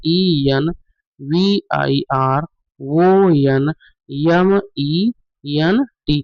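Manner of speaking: slow, letter-by-letter spelling of environment; spelling dictation for students. Shown as a demonstration. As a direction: spell out each letter, pausing between them. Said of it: "इसका सही spelling क्या होता है".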